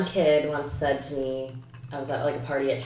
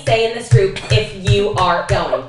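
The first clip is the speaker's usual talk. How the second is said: louder, emphatic rule-giving mom; imitated strict mom; 3 semitones higher, 7 dB louder than usual. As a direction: lay down the law word by word, as mom. Voices mom laying down group rules.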